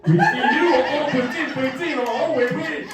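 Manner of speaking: high, laughing French cheer; mock French cheer. Imitating the French people cheering him through the window, high and laughing.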